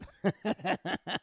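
loud, high real laugh; burst of high laughter. Real, not acted. Son laughs at the airman's praise of dad, loud laugh. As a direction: crack up at the memory. Laughing after retold compliment.

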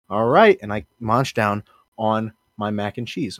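usual speaking voice

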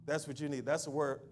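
ordinary talk, nothing marked

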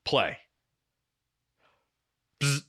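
ordinary talk

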